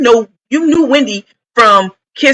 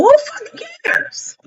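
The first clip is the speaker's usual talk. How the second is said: exasperated; 4 dB quieter than usual. Real, not acted. Exasperated rant.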